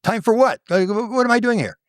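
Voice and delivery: a panicked baffled protest; bewildered Mike. Retold or imitated. Mike asks the council why, high and confused. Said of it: "He said".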